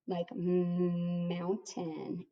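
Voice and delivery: mountain very slowly, leaning on the m; drawn-out letter sound. Shown as a demonstration. Teacher sounding out ment for the class, very slow. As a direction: stretch like mountain to show the m sound. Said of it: "the last syllable is ment".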